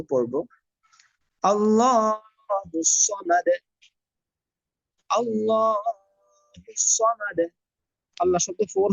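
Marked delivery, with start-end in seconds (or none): 1.5-7.4 slow melodic tajweed chant; Quran recitation. Shown as a demonstration. Teacher reciting for students in slow tajweed chant. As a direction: recite slowly, drawing out each vowel.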